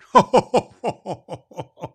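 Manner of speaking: hearty laugh; burst of laughter. Real, not acted. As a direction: laugh out loud at the request. Laughs at the letter.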